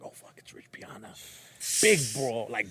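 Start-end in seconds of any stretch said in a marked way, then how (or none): 0.0-1.1 hushed awed whisper. Retold or imitated. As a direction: whisper it in disbelief. Awed aside on seeing Rich.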